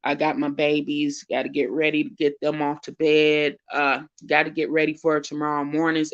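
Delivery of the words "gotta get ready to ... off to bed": normal talking voice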